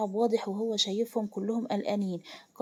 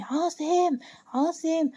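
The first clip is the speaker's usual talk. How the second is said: raised, angry call to a henchman; furious summons; 6 semitones higher, much slower than usual. A narrator's reading. Boss summoning his man Asem in hellish anger. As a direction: call the name out sharply, pitched high. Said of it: "بيقول له بغضب جحيمي".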